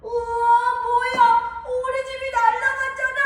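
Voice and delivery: a little pig whose house just blew away; panicked piglet. Acted. Pig's house blown away. (집이 날아가 겁에 질려 도움을 청하는 돼지)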